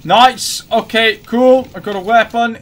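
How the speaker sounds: excited. Real, not acted. Excited gamer finds weapon.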